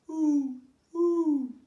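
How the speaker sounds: high, loud spooky owl hoot; eerie high owl call. Acted. Owl hooting in dark forest.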